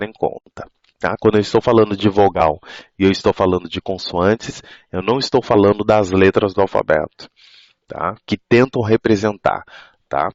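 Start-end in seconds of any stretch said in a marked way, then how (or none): none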